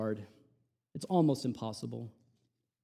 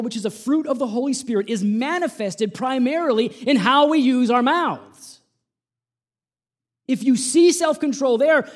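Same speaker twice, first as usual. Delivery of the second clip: much higher and louder, impassioned sermon; preaching at full force; 9 semitones higher, 8 dB louder than usual. Acted. Preacher urging the congregation toward self-control, full-force preaching. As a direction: build to a fiery peak on self-control.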